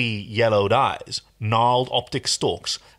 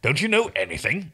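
impatient; 6 semitones higher than usual. Acted. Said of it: "Rigel grew impatient".

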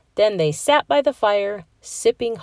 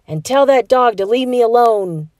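grumpy mumble; 5 semitones higher, 6 dB louder than usual. A narrator's reading. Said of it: "Heather mumbled into her pillow".

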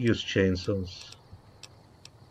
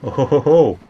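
jolly ho ho ho; 4 semitones higher, 9 dB louder than usual. Real, not acted. Gleeful laugh at finding enemies.

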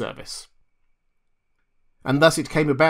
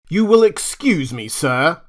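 loud, commanding professor; booming; 4 semitones higher, 8 dB louder than usual. A narrator's reading. Challenger cutting in on Nemore with a booming voice. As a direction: boom it out over the room. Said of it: "boomed Challenger".

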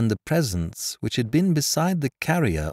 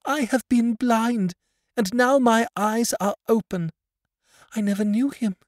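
put-on high, louder girl's voice full of feeling; overcome blind girl; 5 dB louder than usual. A narrator's reading. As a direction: speak to the father she never truly saw. Blind girl embracing her father.

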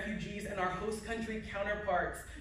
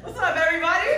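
big, high, loud hello to the crowd; excited shout to the audience; loud greeting; 10 semitones higher, 11 dB louder, faster than usual. Real, not acted. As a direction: call out to the whole room with a laugh. Speaker greets audience on stage.

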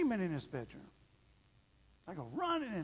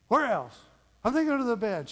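put-on loud, higher back-and-forth of mom and kid; eager monster hunt; 8 semitones higher, 12 dB louder than usual. Mom and son hunting monsters room by room, loud and eager. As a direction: pump up the urgency of the search.

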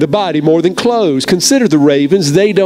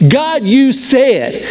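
God, you said, drawn out slowly and higher; slow reverent "God, you said"; slow emphatic prayer; 6 semitones higher, much slower than usual. Shown as a demonstration. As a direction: lift "God, you said" slowly, each word weighted. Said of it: "such powerful words".

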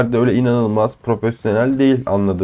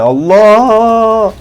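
higher, louder outburst; shocked at sudden death; exasperated cry; 8 semitones higher, 6 dB louder than usual. Real, not acted. Gamer killed suddenly, exasperated cry.

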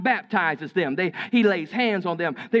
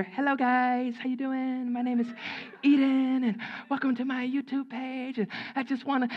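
put-on YouTube host voice, held high; mock YouTuber intro; 6 semitones higher than usual. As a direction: imitate a little girl copying YouTuber greetings. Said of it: "doing mock intros for a YouTube page".

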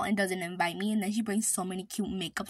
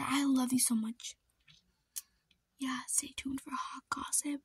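whispered aside; 3 semitones higher than usual. Real, not acted. Aside to viewers behind sister's back, sneaky whisper. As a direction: drop to a secret whisper.

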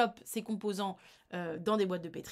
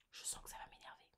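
whispered aside; 20 dB quieter, much faster than usual. Real, not acted. Aside before the annoying article.

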